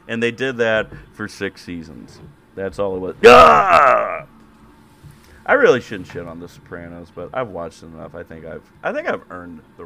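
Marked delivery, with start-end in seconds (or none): 3.2-4.3 gangster yell. Pretend. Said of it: "gabba ga Carmella".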